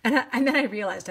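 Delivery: ordinary talk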